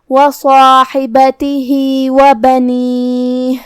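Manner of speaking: slow, drawn-out tajweed chant; measured melodic Quranic recitation. Shown as a demonstration. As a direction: recite it slowly with full elongation.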